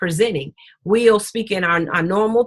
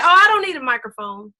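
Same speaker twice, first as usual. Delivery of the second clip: higher, quick brush-off; breezy imitated protest, pitched up; mock refusal; 6 semitones higher, 3 dB louder, faster than usual. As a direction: imitate someone waving off the offer. Quoting speakers who refuse the microphone.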